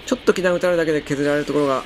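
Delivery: normal talking voice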